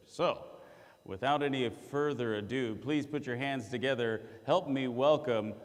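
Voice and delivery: usual speaking voice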